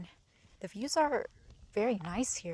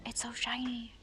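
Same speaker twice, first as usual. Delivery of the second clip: excited whisper; 5 semitones higher than usual. Real, not acted. Excited whispers in gift shop.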